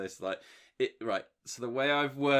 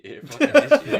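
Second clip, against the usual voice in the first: high, loud yell, laughing at the end; mock accusing shout; 13 semitones higher, 14 dB louder than usual. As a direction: shout it as the nonce hunter, breaking into a laugh. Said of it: "then the guy's like".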